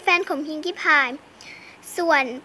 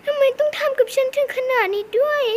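tearful cry; 7 semitones higher than usual. Pretend. Heartbroken pony cries out.

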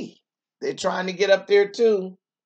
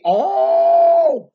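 high, loud gasp of alarm; sudden shocked cry; surprised squeal; 9 dB louder than usual. Acted. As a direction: squeal as the tree tips over. Letters fall from tree.

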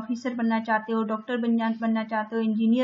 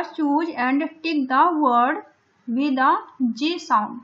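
slow task reading; 2 semitones higher, much slower than usual. Shown as a demonstration. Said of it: "teacher reading the task".

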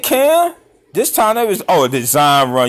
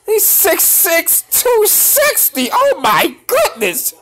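high, slow, drawn-out awe; high stretched-out amazement; awed exclaim. Real, not acted. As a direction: marvel at his size. Awed at the play.

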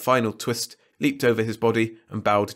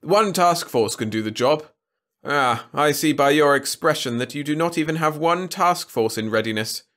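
lordly, lofty king voice; haughty ruler; 6 semitones higher, 4 dB louder than usual. A narrator's reading. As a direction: talk down from the throne. Trescu voiced.

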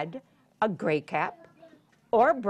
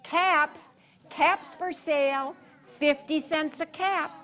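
higher, sing-out peddler's cry; street seller's call; 6 semitones higher than usual. A narrator's reading. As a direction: call out wares on a raised, level tone. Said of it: "walked back to town calling".

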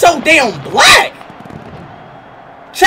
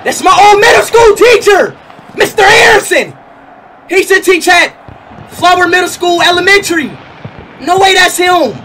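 excited shout; 3 semitones higher than usual. Real, not acted. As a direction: shout like you spotted him. Recognizes old teacher card.

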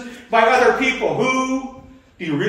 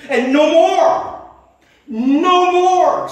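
emphatic insistence; 8 semitones higher, 5 dB louder, much slower than usual. Real, not acted. Preacher insisting on Satan's limits.

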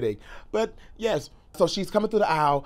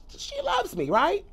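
tiny high-pitched, softer voice; much higher, playfully unsure; hopeful self-reassurance; 14 semitones higher, 5 dB quieter, slower than usual. Retold or imitated. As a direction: squeak it out as a hopeful little question. Acting out his own hopeful thought.

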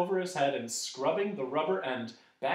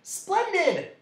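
very high, loud exclamation; delighted cry; 7 dB louder than usual. A narrator's reading. Dodecahedron praising Milo's answer, delighted cry. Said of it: "cried the dodecahedron".

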